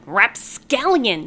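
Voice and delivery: slow, loud drawn-out cry of scorn; cried out. A narrator's reading. Old witch crying out after the fleeing cat, loud. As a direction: fling the insult after the cat. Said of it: "she cried".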